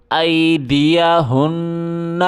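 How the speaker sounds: ordinary delivery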